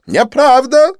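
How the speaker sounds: very high, loud angry denial. Acted. Didier angrily denying Lin's words, high loud cry. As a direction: stamp and deny it. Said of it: "он гневно топнул ногой".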